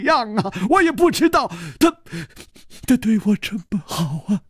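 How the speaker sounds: put-on high, stammering young man near tears; tearful young servant. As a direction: stumble over the words, close to crying. (带着哭腔的年轻仆人)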